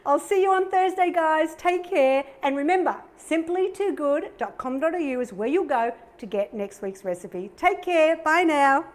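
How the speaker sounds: usual speaking voice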